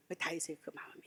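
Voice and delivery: soft hushed whisper. Real, not acted. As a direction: drop to a reverent whisper. Sermon point whispered.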